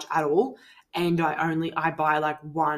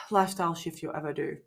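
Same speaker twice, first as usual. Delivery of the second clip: ordinary talk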